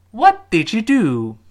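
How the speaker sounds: slow, loud, high "What", long stressed "do" falling; slow model question with both ends stressed. Shown as a demonstration. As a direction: punch "What" and "do", glide through "did you". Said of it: "这个what和最后那个do，注意要重读".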